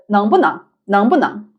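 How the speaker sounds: NENG BU NENG run together, BU neutral; "neng bu neng" as one quick unit; very fast. Shown as a demonstration. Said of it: "When you say it very fast".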